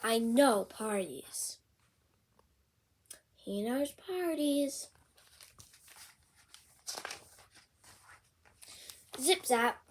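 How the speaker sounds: lively picture-book read-aloud; bouncy storybook reading; reading to children. Acted. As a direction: read it out with big character voices for a kid.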